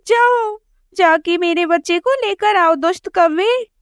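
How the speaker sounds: put-on mother sparrow demanding her baby back; commanding mother sparrow. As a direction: send the wicked crow off. Sparrow orders crow away.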